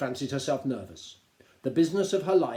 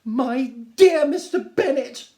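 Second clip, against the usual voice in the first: much higher, louder, slow and drawn out; shrill-voiced Mrs Bennet; 12 semitones higher, 13 dB louder, slower than usual. Wife calling to her husband, high and gushing. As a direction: gush it like a fussy excited wife. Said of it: "replied his wife".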